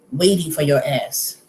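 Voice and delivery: ordinary talk, nothing marked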